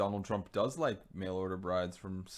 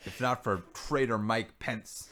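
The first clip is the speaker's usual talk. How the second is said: mock proclamation; 7 dB louder than usual.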